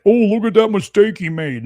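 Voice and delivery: hammed-up imitation of a nitpicking viewer pouncing on a mistake; mocking gotcha. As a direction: jeer like a gleeful critic. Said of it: "they're like".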